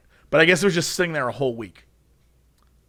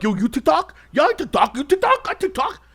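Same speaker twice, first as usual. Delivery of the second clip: mocking dudes; 10 semitones higher than usual. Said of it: "a bunch of dudes being like".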